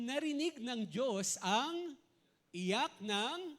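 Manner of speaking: drawn-out, deliberate reading of the verse; solemn slowed verse read; slow dramatic scripture reading. Acted. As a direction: stretch each word out slowly for weight.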